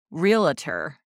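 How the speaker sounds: "realtor" drawn out slowly as real-uh-ter; extra-syllable pronunciation. Retold or imitated. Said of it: "We've heard Nicole, listeners, say".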